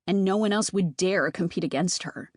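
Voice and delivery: plain reading voice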